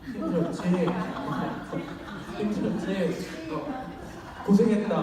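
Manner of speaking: giggling while comforting; half-laughing loving words; saying it to the neighbour with laughter; laughing through it. Pretend. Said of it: "옆에 사람한테 하세요".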